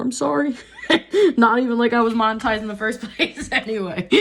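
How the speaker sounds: giggling through the words, pitch up; laughing apology. Real, not acted. Apologizing to viewers for cursing, laughing through it. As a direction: laugh off the slip.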